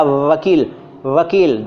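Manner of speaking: वकील with a held, buzzing व; teeth-on-lip V. Shown as a demonstration. Teacher demonstrating the labiodental sound, teeth-on-lip V. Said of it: "नीचे के होंठ को दांत ने दबाया".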